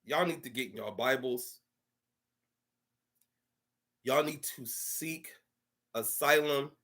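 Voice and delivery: low, soft, measured preaching; solemn exhortation. Real, not acted. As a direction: quietly urge them to repent, with long pauses. Solemn exhortation closing the rant.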